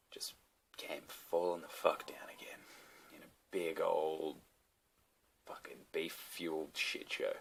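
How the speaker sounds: slow hushed whisper for the punchline; dramatic whisper. Acted. Vlogger to viewers, hushed slow whisper. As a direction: drop to a slow secret whisper.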